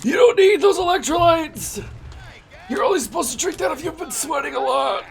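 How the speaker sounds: high, scolding fan voice; nagging viewer voice. Retold or imitated. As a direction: lecture him like a nagging fan. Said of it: "the viewer voice is like this".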